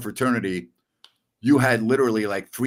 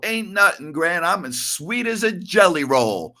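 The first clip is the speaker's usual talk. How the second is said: imitating Carl; 7 semitones higher than usual. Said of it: "And he would go".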